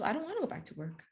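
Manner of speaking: hushed, quick aside as the patient; reluctant patient. Retold or imitated. Patient doesn't want to return to work, hushed reluctance. Patient balks at doctor's news.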